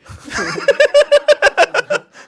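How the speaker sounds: 甲高い爆笑; 思わず吹き出して笑う; 大笑い. Real, not acted. 大笑いする相方. (高い声で大笑い)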